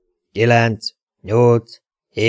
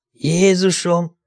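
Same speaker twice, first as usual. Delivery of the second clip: sick groan; 7 semitones higher, much slower than usual. Acted. Dante suddenly feels sick.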